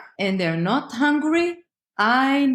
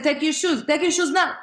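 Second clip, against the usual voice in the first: higher, pressing insistence building to NOW; escalating nag; 7 semitones higher than usual. Retold or imitated. Parent nagging, building.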